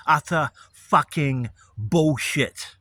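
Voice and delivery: slow, loud, spat-out contempt; furious, deliberate slow condemnation; righteous indignation. Real, not acted. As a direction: drag each word out in disgust. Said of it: "I have to say it like fucking this".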